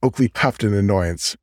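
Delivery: usual speaking voice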